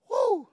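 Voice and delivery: high loud woo of celebration; excited whoop. Real, not acted. Preacher whoops at God's generosity, loud excited. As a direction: whoop like a fan cheering.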